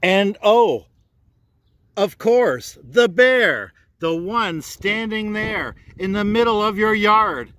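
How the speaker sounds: oh, and of course, the bear!; bright storyteller's reveal; excited reveal. A narrator's reading.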